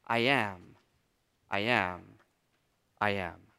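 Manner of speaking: quiet, slow rhythmic repetition; cadence. Shown as a demonstration. Preacher chanting the divine name as a cadence. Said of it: "And it's like a cadence".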